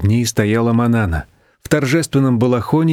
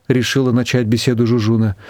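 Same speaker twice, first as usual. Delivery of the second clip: usual speaking voice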